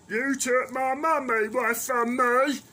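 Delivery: put-on high, loud, slow whiny child; mock child voice. Mocking the child who blames him, high and whiny. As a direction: whine it like a sulking kid mocking them.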